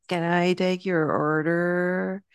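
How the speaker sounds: slow, drawn-out I-don't-care tone; made-up teenage tone. Retold or imitated. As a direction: imitate a teenager's put-on drawl, stretching the words. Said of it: "tone that is made up".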